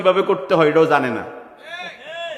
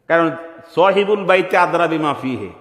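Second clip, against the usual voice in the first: usual speaking voice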